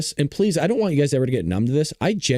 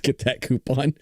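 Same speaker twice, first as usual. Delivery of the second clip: commercial voiceover. Retold or imitated. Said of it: "I don't know why I said".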